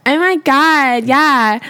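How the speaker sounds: mock Valley girl accent. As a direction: put on a ditzy Valley girl voice. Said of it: "if you sound like a Valley girl".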